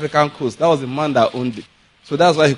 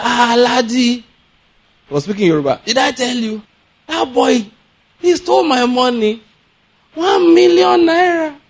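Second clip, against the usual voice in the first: lightly voiced rich old man, offhand; casual rich father; 7 semitones higher than usual. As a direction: toss off the loss like nothing, slightly higher. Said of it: "Just said it casually like that".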